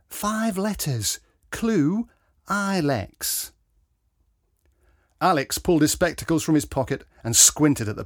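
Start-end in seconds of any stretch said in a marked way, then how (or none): 0.1-3.5 higher, slow, spelling out a crossword clue; puzzled Mrs Tyson. A narrator's reading. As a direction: read the clue slowly, thinking aloud. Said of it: "Missus Tyson said".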